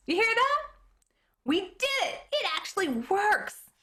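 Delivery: high, thrilled shouts of triumph; boy yelling with joy as the radio plays; excited cheering. Acted. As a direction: cheer like the machine just came alive. Boy's windmill radio finally plays.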